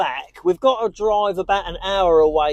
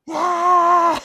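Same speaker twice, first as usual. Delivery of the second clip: triumphant cheer; 11 semitones higher than usual. Real, not acted. Owner cheering as his dog finally pees. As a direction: celebrate like a goal went in.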